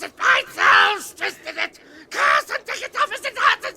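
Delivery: high, loud shrieks of pain; pained screaming. Acted. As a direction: scream as the rope burns.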